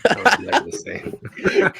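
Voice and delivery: high, slow, wheezing with laughter; laughing hard. Real, not acted. Repeating mom's joke about the birth, laughing hard. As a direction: repeat it through helpless laughter.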